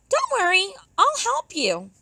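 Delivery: high, bright-voiced hare reassuring. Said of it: "said the hare".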